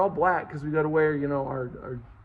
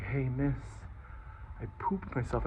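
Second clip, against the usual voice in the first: put-on softer, shamefaced little-boy admission; sheepish confession; 4 semitones lower, 9 dB quieter than usual. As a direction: confess it quietly to the teacher. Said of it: "and I'm like".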